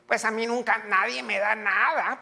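mock the stingy complainer; whiny complainer. Preacher mimics a stingy churchgoer's whining complaint. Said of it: "has de ser un tacaño".

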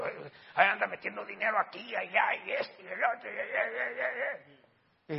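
mock the gossiping accusers. Pastor mocking critics who accused him, sneering whine. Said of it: "como me acusaron esta gente".

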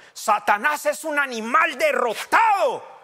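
loud, high, slow shout; retold proclamation. Pastor retelling his sermon in Phoenix, loud slow shout. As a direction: proclaim it like a victory cry. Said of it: "y dije esto".

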